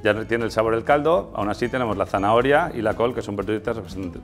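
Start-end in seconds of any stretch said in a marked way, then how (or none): none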